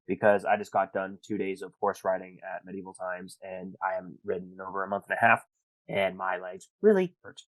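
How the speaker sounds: ordinary delivery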